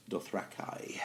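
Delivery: hushed whisper of "Dothraki", ending on "kai". Shown as a demonstration. Podcaster whispering the author's pronunciation of Dothraki. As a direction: breathe "Dothraki" like a secret. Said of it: "Martin actually pronounces Dothraki".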